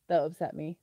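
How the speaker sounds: ordinary delivery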